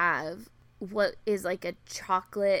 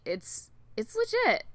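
usual speaking voice

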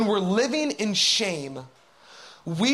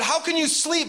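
higher-pitched, urgent challenge; captain's raised, pressing voice; alarmed captain; 7 semitones higher than usual. Acted. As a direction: rouse a sleeper with an incredulous demand. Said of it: "The captain went down to him and said".

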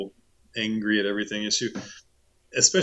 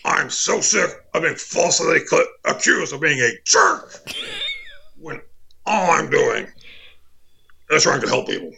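Alex Jones rant; 6 semitones higher than usual. Pretend. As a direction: rant like a wronged talk-radio host.

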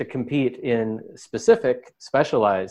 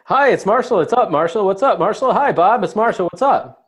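rapid, loud, high announcer patter; old-timey radio announcer; 8 semitones higher, 5 dB louder than usual. Acted. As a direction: rattle it off like an auctioneer. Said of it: "old-timey radio announcers were good at that".

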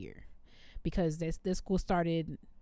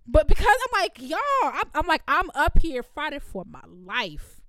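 higher, louder fed-up cry, slowing at the end; exasperated outburst; 7 semitones higher, 8 dB louder than usual. Retold or imitated. Teacher telling students she's fighting for her life, louder and exasperated. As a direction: let the frustration burst out. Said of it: "so I'm out of breath".

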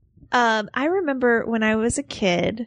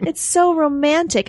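put-on higher-pitched, gushing kid; swooning child; 7 semitones higher than usual. Recalling herself at nine or ten, swooning child. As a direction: gush over ROMANTIC like a starry-eyed child. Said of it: "like, nine or ten years old".

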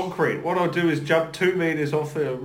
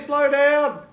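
coach calling; 9 semitones higher than usual. Retold or imitated. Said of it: "Don't play how you play".

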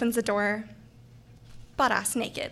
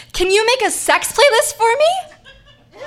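high, slow, eager ask; peppy boy voice; 11 semitones higher, 6 dB louder, slower than usual. Retold or imitated. A peppy resident asking the RA, a high eager ask. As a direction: ask it wide-eyed and delighted.

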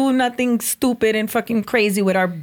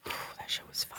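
whisper; 21 dB quieter than usual. Acted. Whispered repeat.

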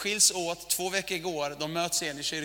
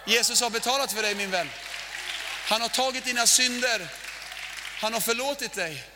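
louder, earnest preaching to the congregation; 3 semitones higher, 3 dB louder than usual. Acted. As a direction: press it home to the listener. (allvarlig predikan till församlingen)

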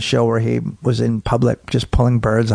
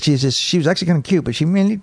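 usual speaking voice

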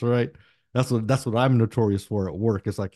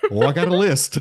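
gleeful boast; 6 semitones higher, 5 dB louder than usual. Retold or imitated. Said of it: "He's like".

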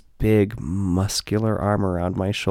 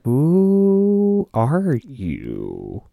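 very slow, drawn-out amazed question; slow wondering who are you; stunned disbelief; 5 semitones higher, much slower than usual. Retold or imitated. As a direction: stretch it out, dumbfounded. Stunned at crowd of fans.

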